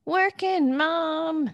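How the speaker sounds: slow, high, put-on sing-song; mock working mom. Teasing her cohost with a put-on working mom voice. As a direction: ham up the proud working mom. Mocking catchphrase.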